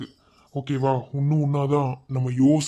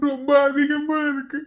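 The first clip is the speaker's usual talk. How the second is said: high, slow, crying voice; tearful longing; 10 semitones higher, slower than usual. Acted. New father aches for his missing wife, tearful wail. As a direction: break down in joy and longing, drawing words out.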